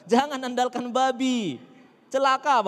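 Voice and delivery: high, loud, drawn-out warning; emphatic exhortation. Real, not acted. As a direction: call out the warning slowly and loudly. (seruan peringatan yang lantang)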